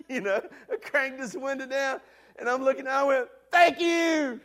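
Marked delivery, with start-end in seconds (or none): 3.5-4.4 loud, high hollered thank-yous to the crowd; hollering thanks. Retold or imitated. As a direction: wave at the crowd and yell your thanks like a hero. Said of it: "I start hollering out".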